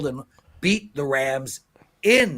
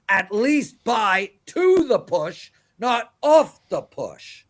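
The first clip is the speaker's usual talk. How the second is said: emotional; 2 semitones higher, 3 dB louder than usual. Real, not acted. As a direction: plead hard, drawing out the key words. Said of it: "Sorry, I a little, little bit, uh, emotional".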